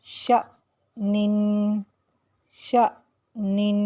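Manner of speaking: شأنن recited slowly with the hamza sharply stopped; Quranic recitation demo. Shown as a demonstration. Teacher reciting شأنن slowly for students. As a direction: stop the voice on the hamza in شأنن, then release. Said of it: "جیسے".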